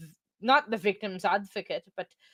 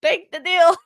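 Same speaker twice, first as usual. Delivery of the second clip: high mock quote; 8 semitones higher, 10 dB louder than usual. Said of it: "says William".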